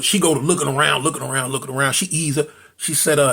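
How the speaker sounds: usual speaking voice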